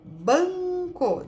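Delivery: BANCO with the nasal vowel held long before "co"; som nasal demonstrado. Shown as a demonstration. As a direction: stretch the nasal in "banco", syllable by syllable. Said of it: "Som nasal indicado por N". ("banco" com a vogal nasal bem alongada)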